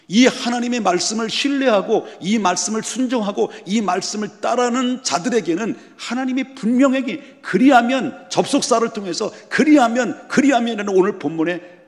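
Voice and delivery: high, loud preaching climax; fervent preaching. Acted. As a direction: preach it out with full fire. Preaching at full force. (열정적으로 외치는 설교)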